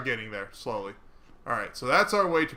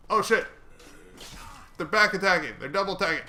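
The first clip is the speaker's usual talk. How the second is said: alarmed; 6 semitones higher, 3 dB louder, much faster than usual. Real, not acted. Enemies attacking suddenly.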